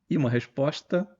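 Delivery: ordinary delivery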